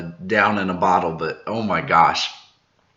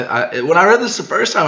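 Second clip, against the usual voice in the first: ordinary delivery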